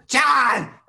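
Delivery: high, loud yell of the name; shouted name. Retold or imitated. Teen yelling along with the radio, loud high shout. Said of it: "turn it up really loud and then go".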